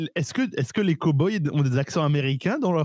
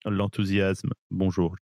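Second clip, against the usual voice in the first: flat sarcastic; 8 semitones lower, slower than usual. Real, not acted. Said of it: "Hicham ou de l'enthousiasme?".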